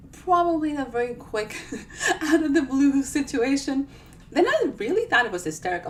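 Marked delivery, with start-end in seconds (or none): 1.9-3.9 slow, laughing through the words. Real, not acted. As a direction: giggle your way out of the sentence. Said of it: "laughs mid sentence".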